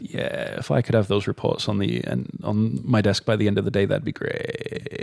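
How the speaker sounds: quiet, slow, unvoiced deadpan boss drone; boss impression. Retelling the famous desk scene, a soft slow boss drone. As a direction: mumble it like a bored office boss.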